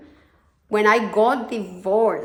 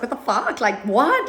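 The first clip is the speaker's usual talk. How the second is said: shocked cousin; 4 semitones higher than usual. Retold or imitated. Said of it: "he was in shock".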